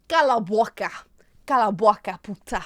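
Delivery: playful Portuguese accent demo; punchy Portuguese pronunciation. Shown as a demonstration. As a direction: show off some Portuguese. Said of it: "I can also speak Portuguese".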